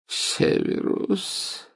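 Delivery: very slow breathless whisper; barely audible. A narrator's reading. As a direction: plead with the last breath. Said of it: "еле слышный". (еле слышный шёпот)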